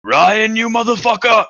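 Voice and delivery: high, loud furious yell; yelling at Ryan across the room; angry teacher yell. Retold or imitated. Teacher screaming at a student on his phone. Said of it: "It was like".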